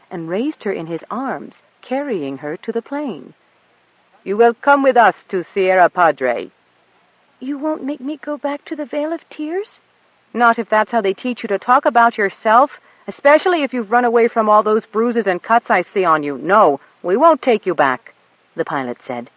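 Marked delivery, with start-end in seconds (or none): 4.2-6.5 lightly voiced elder, firm and louder. A narrator's reading. Elder tells her plan. 7.4-9.8 put-on high, humble little girl begging forgiveness; meek pleading girl. A narrator's reading. Goat Dung begs the pilot's pardon in a high meek voice. Girl's frightened question. 10.3-18.1 louder, hearty and kind, with a folksy lilt; reassuring pilot. A narrator's reading. The pilot cheerfully coaxes the girl aboard, louder.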